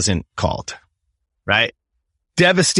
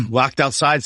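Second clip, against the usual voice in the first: ordinary talk, nothing marked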